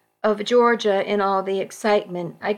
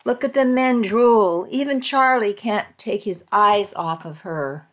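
ordinary delivery, nothing marked

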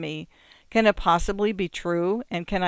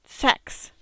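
higher, unvoiced hissed word; fierce hiss; 6 semitones higher than usual. A narrator's reading. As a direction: hiss it with a leer. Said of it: "with fierce hiss".

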